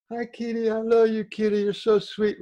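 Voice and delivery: high, cooing sing-out to a little kitten; gushing over a kitty. Shown as a demonstration. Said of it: "My voice changed".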